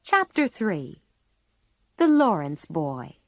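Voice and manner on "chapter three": ordinary delivery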